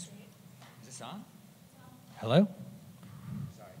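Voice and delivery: ordinary talk, nothing marked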